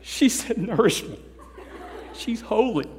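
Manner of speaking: high, laughing proud mother; a mom showing off her kid's prayer; gushing mom. Retold or imitated. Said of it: "your mom went".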